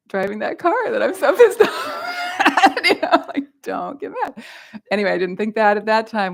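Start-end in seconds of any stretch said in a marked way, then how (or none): 0.1-4.4 high, loud laughing exclamation. Real, not acted. Teacher laughing imagining her student in the car. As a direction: laugh as the idea lands.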